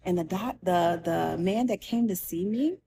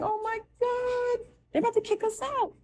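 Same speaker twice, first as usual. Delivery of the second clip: retold panic; 13 semitones higher than usual. Patient seeing police pass her curtain, high panicked dread. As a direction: pitch way up, reliving the alarm.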